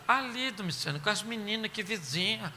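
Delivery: calm him down gently; soothing. Retold or imitated. Aunt soothes uncle.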